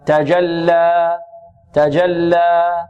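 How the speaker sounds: very slow تجلى, final a held two counts and left open; measured open ending. Shown as a demonstration. Teacher modelling the stop on alif, open two-count vowel. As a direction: hold the last a of تجلى pure, without a glottal close. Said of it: "نقف عليها بمقدار حركتين بدون تقليل".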